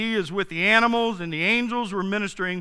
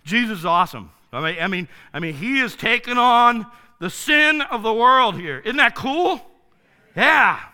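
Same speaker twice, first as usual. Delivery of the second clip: ordinary talk, nothing marked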